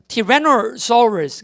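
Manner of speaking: "Tyrannosaurus"를 티레너소러스로 느리게; 영어식으로 "Tyrannosaurus"의 saurus를 소러스로 또박또박. Shown as a demonstration. 영어식 발음 시범. ("Tyrannosaurus", 사우루스가 아닌 소러스로 천천히)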